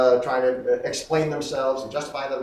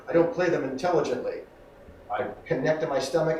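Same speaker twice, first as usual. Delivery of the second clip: ordinary talk, nothing marked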